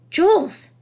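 high, sharp call of the name; loud interruption. A narrator's reading. Grandmother waving a hand in her son's face, loud. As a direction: cut in loudly to get attention. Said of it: "said Grandmother loudly".

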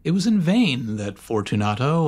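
ordinary delivery, nothing marked

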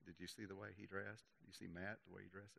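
hushed mock gossip; gossipy. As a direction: imitate a gossip keeping it secret. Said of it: "little gossipy thing going on".

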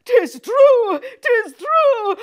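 high, loud dismay; anguished realisation. Acted. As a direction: gasp out the horror. Guilt revealed.

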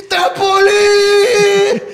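high, wailing, long-drawn yell; hammed-up drunk fan begging at full volume; mock drunken shout. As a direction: wail the request like a sozzled uncle. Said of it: "Mi tío estaba, pero zampado".

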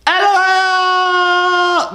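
very high shriek with a long held O; yelled. Acted. Teller reading her many-O reply, yelled long. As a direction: stretch the last letter into a squealed yell. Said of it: "with mad O's and she yelled it".

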